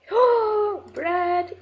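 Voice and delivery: high delighted oh and gasp; thrilled at the achievement; excited gasp. Real, not acted. Gamer earning the bake bread achievement, delighted.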